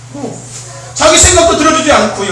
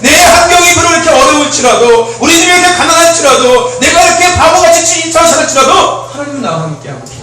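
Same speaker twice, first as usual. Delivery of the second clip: loud, higher proclamation, full force; rousing preaching; 5 semitones higher, 5 dB louder than usual. Real, not acted. Pastor rallying the congregation's faith, loud and fervent. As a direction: declare it to the congregation with fire.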